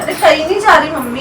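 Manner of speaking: firm soothing promise; loud reassurance. Real, not acted. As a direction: insist she isn't leaving. Comforting crying mother.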